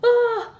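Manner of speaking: sudden high, loud yelp of fright; real high-pitched "ah" of alarm; startled scream. Real, not acted. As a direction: let out a short, sharp frightened cry. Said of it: "it's scaring me".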